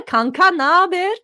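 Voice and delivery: teasing greeting. Shown as a demonstration.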